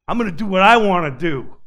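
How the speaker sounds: loud, fast, stubborn declaration; defiant loud vow. Acted. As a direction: shout it out as stubborn self-will. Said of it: "It's the fallen nature".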